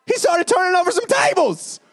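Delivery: high loud shouted peak; preaching shout. Real, not acted. Preacher telling of Jesus overturning tables, shouting high. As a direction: shout it out from the pulpit.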